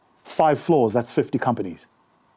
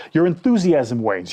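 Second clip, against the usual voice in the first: ordinary delivery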